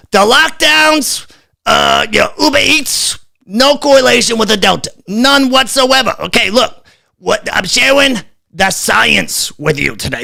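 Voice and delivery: lampoon the health official's patient lecture; Fauci impersonation. Retold or imitated. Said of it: "I just had to do the Fauci impersonation".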